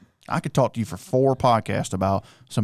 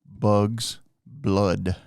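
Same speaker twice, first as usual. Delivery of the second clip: slow, each word set apart; 2 semitones lower, much slower than usual. Shown as a demonstration. Repeating the tongue twister for a confused co-host, word by word. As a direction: pause after every word, spell it out for them.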